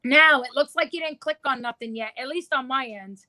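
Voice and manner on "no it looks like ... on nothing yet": ordinary delivery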